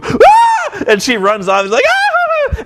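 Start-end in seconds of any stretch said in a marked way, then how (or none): 0.0-0.8 loud high-pitched scream of panic; mock girly shriek. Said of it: "Velvet's attitude changes ... badass smugness to". 1.9-2.6 long high falsetto scream; mock girly shriek. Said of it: "running around the ring like a girl".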